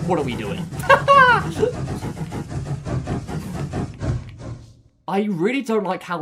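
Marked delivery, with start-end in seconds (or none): 0.6-4.6 high breathless squeak of a laugh; airy squeal laugh. Real, not acted. Laughing at the game's mess, high breathless squeal. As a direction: wheeze out a laugh.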